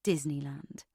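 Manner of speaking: low, soft, contemptuous Disneyland; disgusted. Shown as a demonstration. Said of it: "Let me say that one more time".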